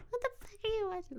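mocking his imitation. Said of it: "I never came in".

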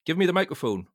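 snatch the mic with a loud command; ordered. Acted. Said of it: "ordered Mason".

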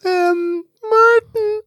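squeaky high Martin; scared mouse. Pretend. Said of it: "that sounded like a scared mouse".

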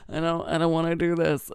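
mock-reluctant complaint; reluctant whine. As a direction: whine it in playful protest. Acts out reluctant protest.

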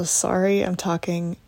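normal talking voice

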